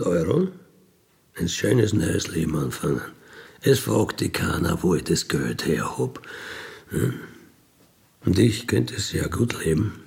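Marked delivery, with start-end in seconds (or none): none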